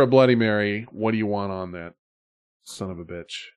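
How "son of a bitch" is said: ordinary talk, nothing marked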